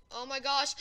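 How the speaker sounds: ordinary talk, nothing marked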